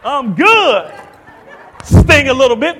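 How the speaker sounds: loud, high hammed-up reassurance; mock pained bravado. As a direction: play wounded but insisting, at full volume. Acts shrugging off a gunshot.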